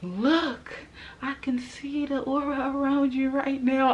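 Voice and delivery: high, loud excited quote; excited friend quote. Retold or imitated. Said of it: "She was like".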